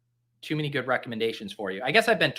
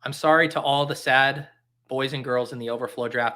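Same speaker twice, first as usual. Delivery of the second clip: normal talking voice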